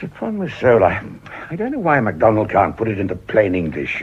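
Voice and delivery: ordinary delivery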